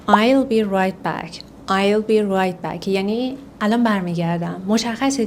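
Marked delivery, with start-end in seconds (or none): 0.1-1.5 slow model phrase. Shown as a demonstration. 1.7-2.9 slow model phrase. Shown as a demonstration.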